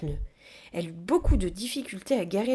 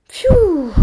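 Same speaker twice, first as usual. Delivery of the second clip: loud, high whew of relief; exhausted puff; 11 semitones higher, 14 dB louder than usual. A narrator's reading. Exhausted gorilla after pushing the caravan, puffing out. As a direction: blow out your breath, worn out. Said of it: "souffla-t-elle".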